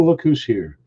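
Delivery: ordinary talk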